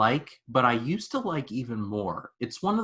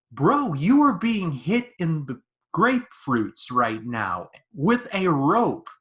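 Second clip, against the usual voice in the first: higher, louder, drawn-out disbelief; incredulous mock scolding; 6 semitones higher, 5 dB louder, slower than usual. Viewer telling off Bond mid-torture, incredulous. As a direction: stretch each phrase in exasperated disbelief.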